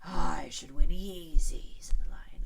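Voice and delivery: slow, loud breathy lion; lazy confidence; lion's boast. A narrator's reading. Lion boasting he'd win, slow and breathy.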